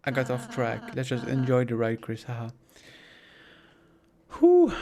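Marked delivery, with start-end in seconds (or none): 4.4-4.7 shrill relieved whew; high loud whew. Acted.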